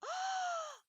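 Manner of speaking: sharp high gasp; startled gasp. Real, not acted. Player spotting a rare animal, startled gasp. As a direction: gasp in sudden surprise. Spots an albino emu.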